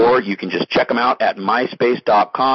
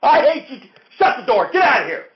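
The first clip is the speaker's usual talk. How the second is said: screaming rage. Acted. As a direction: scream him out, slam the door. Host screams during quarrel.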